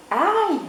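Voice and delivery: sharp high ouch; cry of pain. Acted. Chick yelping as the hens peck him. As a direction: yelp as the beaks hit. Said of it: "s'écrie Poussin".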